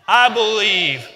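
loud, slow, drawn-out shout; group shout. Real, not acted. Preacher leads the church in a loud shout. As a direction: shout it out together.

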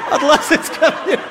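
high and loud, laughing through it; real laughter at his own joke. Real, not acted. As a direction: crack up while repeating the punchline. Repeats joke while laughing.

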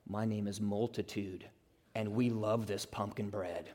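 low, hushed, deadpan creepy reply; playful possessed-man parody; mock-ominous multitude. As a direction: freak them out with a soft, low answer. Joke answer as a spooky multitude.

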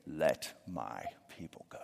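put-on whispered like a frail old man in a walker; a faint old man's whisper; feeble old man whisper. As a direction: breathe it out like a tired eighty-year-old. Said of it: "I just picture him saying".

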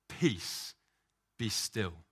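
a firm, voiced peace, be still; lightly voiced Jesus commanding the storm.

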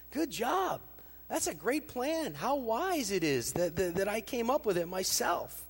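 high, loud smug praise of himself; self-congratulating. Acted. Rich man congratulating himself, high and smug. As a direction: pat yourself on the back out loud. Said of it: "patting himself on the back".

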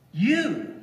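"You" said high and pointed; stress on YOU. Shown as a demonstration. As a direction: land hard on "You". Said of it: "the emphasis is on the word "you".

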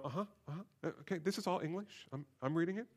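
soft, mumbled uh-huhs of someone skimming; mock absent-minded reader. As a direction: imitate a reader nodding along without really getting it. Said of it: "you'll go".

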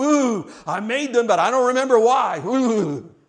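hammed-up, drawn-out disgusted Ooh; mock disgusted God. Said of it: "hiding behind the veil of his holiness and say".

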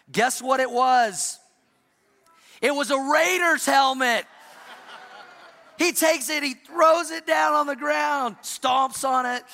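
loud, high build to the punchline; excited storytelling. A narrator's reading. Pastor telling the congregation about the Raiders helmet, loud and high. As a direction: shout it as the story peaks. Son's tantrum over wrong helmet.

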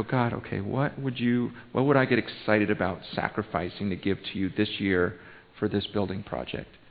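low, soft, thoughtful prayer; private prayer. Acted. Pastor modelling what to ask God about sacrificing, in quiet prayer. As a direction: ask it softly, as if alone with God. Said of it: "just between you and God".

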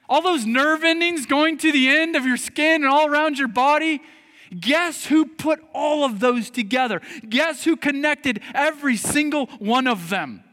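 high, loud preacher's delivery; impassioned preaching. Acted. Preacher pressing congregation on who made their bodies, impassioned preaching. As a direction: drive it home, loud and high-pitched.

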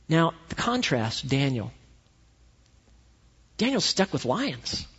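ordinary delivery

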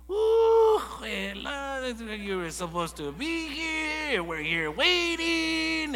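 high, slow, drawn-out motherly reproach; scolding mom. Retold or imitated. Mom on the phone scolding her late son, high and drawn out. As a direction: stretch it out like a disappointed mom.

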